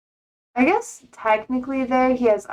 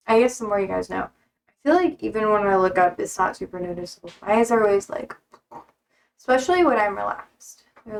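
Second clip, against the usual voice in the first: ordinary talk, nothing marked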